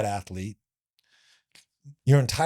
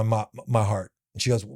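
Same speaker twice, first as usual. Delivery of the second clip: ordinary delivery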